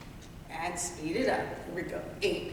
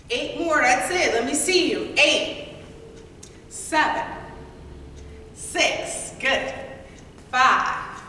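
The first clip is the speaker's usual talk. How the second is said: loud; 6 semitones higher, 4 dB louder, slower than usual. Real, not acted. Instructor cheering class to finish.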